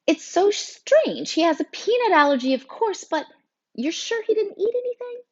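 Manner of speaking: very high, puzzled teacher voice; flustered teacher. A narrator's reading. Teacher asking students about an allergy, flustered.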